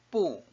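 "bu" falling sharply from high; fourth-tone bu. Shown as a demonstration. Teacher reviewing the tones of bu, falling fourth tone. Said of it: "is pronounced as a fourth tone".

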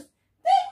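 squeaky sparkle ding; high magic ding. Pretend. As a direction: chime it like a wand. Magic wand spell.

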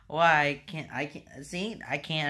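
usual speaking voice

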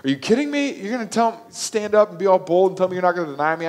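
hurried mock indignation; ticked off. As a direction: scoff as if fed up with a friend. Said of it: "I'd have been all kinds of ticked".

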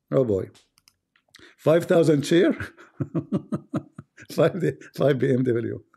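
normal talking voice

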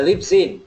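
teacher's firm model linking "lives" onto "in"; linked lives in. Shown as a demonstration. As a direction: punch out "lip-sin" as one word. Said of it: "repeat after me".

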